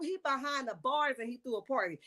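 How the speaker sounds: usual speaking voice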